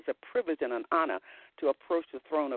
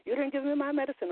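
high-pitched mock complaint; imitated fussing; 10 semitones higher than usual. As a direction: lightly act out a fussing customer. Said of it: "fussing with the people".

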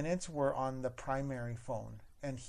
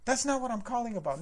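higher, louder indignant caller; raised defensive retort; defensive customer; 9 semitones higher, 5 dB louder, faster than usual. Retold or imitated. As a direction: brush off the question. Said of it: "And he's like".

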